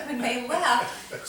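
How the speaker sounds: slow, laughing through the words; can't get it out for laughing; laughing while retelling the story. Real, not acted.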